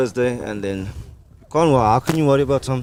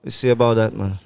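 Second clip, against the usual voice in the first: put-on West Indian accent. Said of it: "very good West Indian accent".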